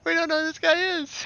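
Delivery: laughing in disbelief; burst of laughter. Real, not acted. Player laughing at the stranger's mix-up, loud and amused.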